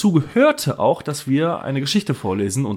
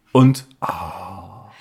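ordinary delivery

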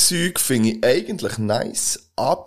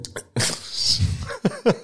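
breathy giggle; 10 semitones higher than usual. Real, not acted. Laughs at algae sip.

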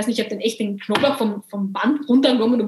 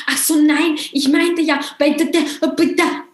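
higher, stammering mock protest; flustered backpedalling; 4 semitones higher than usual. Caught-out man excusing himself to the shopper, flustered. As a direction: trip over the excuse, pitched up.

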